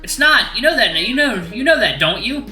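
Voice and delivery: high-pitched agitated protest; exasperated arguing. Acted. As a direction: press the point insistently. Agitated arguing with wolf giant.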